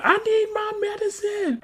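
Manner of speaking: put-on slow, very high kid voice; mock whiny child. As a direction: imitate a little boy needing something. Voices the young nephew.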